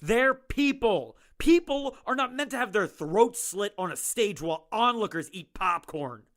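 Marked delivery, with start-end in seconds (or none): 0.0-3.4 firm insistence. Acted. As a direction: lean hard on every word. Doctor insists against warden.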